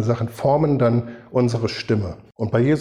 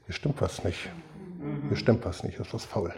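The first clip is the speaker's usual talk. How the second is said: near-whispered, much softer warning to yourself; hushed inner voice; 11 dB quieter than usual. Acted. Preacher voicing the believer's inner alarm, hushed and soft. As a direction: murmur it like a quiet gut feeling that something's off. Inner voice sensing something wrong.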